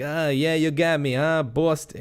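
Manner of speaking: mock Boston gangster accent. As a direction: play a wiseguy from Boston who's just been caught. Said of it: "like some Boston accent".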